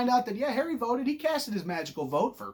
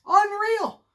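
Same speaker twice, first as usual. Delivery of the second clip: normal talking voice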